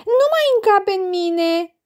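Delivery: put-on high, whiny little-girl whimper; high childish snivel. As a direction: whine like a child who is full. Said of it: "se zmiorcăie Betina".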